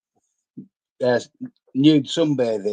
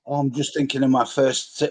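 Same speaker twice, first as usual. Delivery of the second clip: ordinary talk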